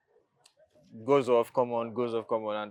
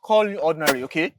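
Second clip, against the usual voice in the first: rising frustrated yell, higher and louder as it goes; angry shout; 8 semitones higher, 8 dB louder than usual. Acted. Brother snapping at his sister Loretta over the broken car, angry shout. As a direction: shout him down, pitch climbing with every line.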